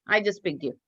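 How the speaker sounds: normal talking voice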